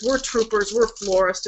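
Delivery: ordinary talk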